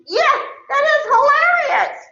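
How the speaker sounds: very high, hammed-up hilarity; exaggerated high-pitched gushing; mock enthusiasm. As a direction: squeal it as if it were the funniest thing ever. Acts out an over-keen response.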